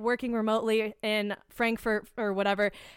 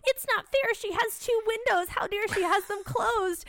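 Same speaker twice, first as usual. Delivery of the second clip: much higher, mocking whine, slowing at the end; whiny entitled passenger; 9 semitones higher than usual. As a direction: mimic a spoiled traveller demanding the window.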